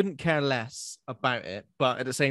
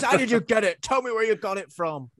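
a higher, louder demand; livid; 7 semitones higher, 3 dB louder than usual. Retold or imitated. Furious demon interrogating Carly about the spear, livid demand. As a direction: bark the question at her. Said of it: "Oh, he's livid".

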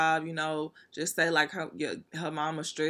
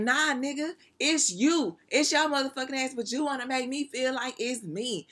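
mock girlfriend; 8 semitones higher than usual. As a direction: play the offended girlfriend, pitched up. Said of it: "she gonna be like".

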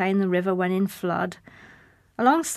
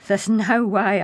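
louder, scornful dismissal; scoffing; 8 dB louder, slower than usual. Acted. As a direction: scoff at the very idea. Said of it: "I scoffed".

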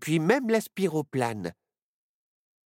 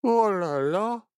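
put-on slow, yawning boy, much higher; sleepy yawned lines; 7 semitones higher, 3 dB louder, slower than usual. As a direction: stretch the words through a yawn. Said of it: "bâilla Barnabé".

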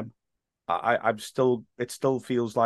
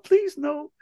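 very high, helpless mock plea; mock pleading. Begging angry fans to spare him, mock high-pitched plea. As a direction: beg in a tiny high voice.

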